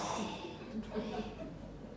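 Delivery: long voiceless sigh of defeat; exhausted sigh. Retold or imitated. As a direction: sigh like you can't get up. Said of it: "You're like".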